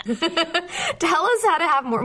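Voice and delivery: laughing. Real, not acted. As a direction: say it while cracking up. Laughing at guest's phrasing.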